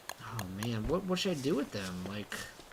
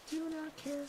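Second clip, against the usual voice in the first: much higher, soft squeaky "Do not care."; squeaky dismissive; 13 semitones higher, 9 dB quieter than usual. Shown as a demonstration. Streamer ignoring the dying rabbit, high and squeaky. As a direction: toss it off in a tiny high voice. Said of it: "Don't care".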